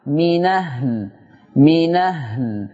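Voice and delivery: من أهل slow, read as منَ هل; demonstrating naql. Shown as a demonstration. Teacher demonstrating من أهل slowly. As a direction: say من أهل slowly, nun opened. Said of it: "نقل فتحة الهمزة إلى النون".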